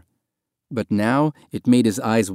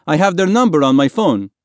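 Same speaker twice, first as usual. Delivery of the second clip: loud, raised-pitch call; 6 semitones higher, 6 dB louder than usual. A narrator's reading. Man in the dinghy to swimmers below, calling out loudly. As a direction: shout it across to them.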